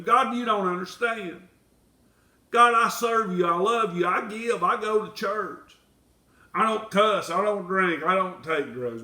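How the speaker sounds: high, loud self-righteous complaint; outburst against God. Acted. Believer complains to God about his good deeds, loud and high. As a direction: list your good deeds at God, aggrieved. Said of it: "How many of us went to him".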